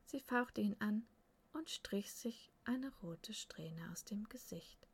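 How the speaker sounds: usual speaking voice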